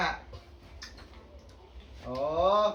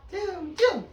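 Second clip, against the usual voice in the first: high squeaky cium; 12 semitones higher, 4 dB louder than usual. Acted. Lagu anak, teriak cium.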